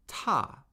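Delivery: "ta" falling sharply from high; fourth tone. Shown as a demonstration. Teacher modelling tones for repeating, "ta" high then falling. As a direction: start "ta" high and drop it steadily. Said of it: "the fourth tone steadily falls".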